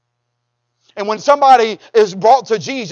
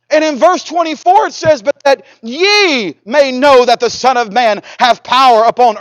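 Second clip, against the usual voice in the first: raised, emphatic sermon proclamation; forceful preaching; 3 semitones higher, 5 dB louder than usual. Real, not acted. Preacher declaring Jesus is the Messiah, forceful proclaiming. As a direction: drive each phrase home to the congregation.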